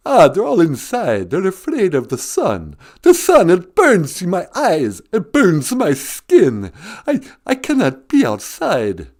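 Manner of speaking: slow, high vampire lament; Count voice. Acted. The Count telling the host why he hides. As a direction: moan about the burning sun. Count explains fearing sun.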